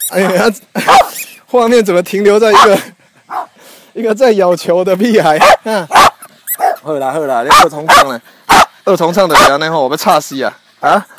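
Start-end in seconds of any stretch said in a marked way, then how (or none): none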